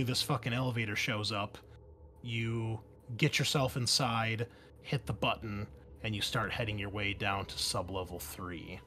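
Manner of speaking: ordinary delivery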